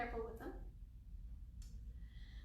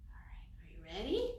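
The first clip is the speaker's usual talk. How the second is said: whisper; 5 semitones lower than usual. Acted. Teacher whispers before song.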